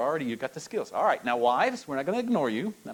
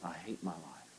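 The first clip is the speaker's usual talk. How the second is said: much softer, hopeless; despairing; 6 semitones lower, 14 dB quieter than usual. Retold or imitated. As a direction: hand the despair over quietly. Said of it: "that's what they would say".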